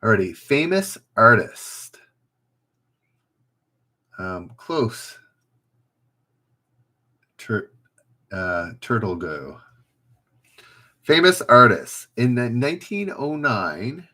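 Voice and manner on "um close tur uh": usual speaking voice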